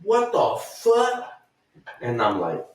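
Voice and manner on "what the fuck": high, slow, drawn-out voice of another man; stranger's what the fuck. Retold or imitated. A man in the next stall reacts, high drawn-out voice. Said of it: "and then you hear".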